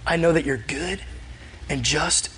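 hushed, trusting prayer with pauses; intimate appeal. Acted. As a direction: draw near and gently reason with God. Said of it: "in this intimate way".

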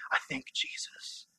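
a slow, breathy whisper of the answer. Real, not acted. Preacher giving the crowd his answer, whispering. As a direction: lean in and breathe it out. Sermon climax, hushed answer.

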